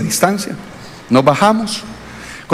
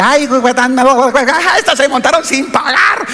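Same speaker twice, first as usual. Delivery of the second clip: laughing mockery; 9 semitones higher than usual. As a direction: laugh at them while calling it out, pitched high. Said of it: "empezó a reírse".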